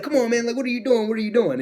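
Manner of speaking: high, loud imitation of a panicked wrestler; mock alarmed protest.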